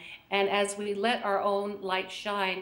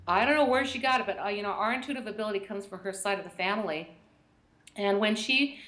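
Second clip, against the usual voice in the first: ordinary delivery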